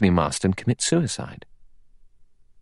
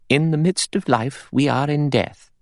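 higher, clear pious pronouncement; 7 semitones higher than usual. Acted. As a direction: intone it like a scripture. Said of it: "said in a clear voice".

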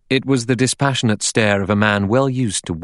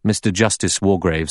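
ordinary delivery, nothing marked

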